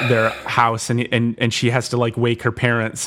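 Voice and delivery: ordinary talk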